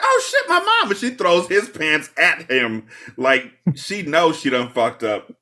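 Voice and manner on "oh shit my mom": put-on panicked girl. Lorraine panicking at mom.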